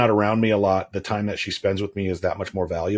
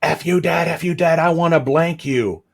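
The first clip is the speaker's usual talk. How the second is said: lightly voiced furious little girl; daughter blowing up; 7 semitones higher than usual. Father retelling his daughter's outburst, higher mock tantrum. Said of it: "and then she says".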